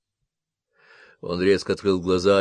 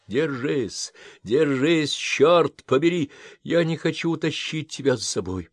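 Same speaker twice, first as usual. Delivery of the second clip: desperate shout; 6 semitones higher than usual. Acted. Jack pleads, holding Jennifer.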